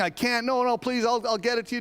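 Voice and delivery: a touch of high begging; lightly voiced pleading debtor. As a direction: beg a little, echoing the first servant. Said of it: "he starts doing the same thing".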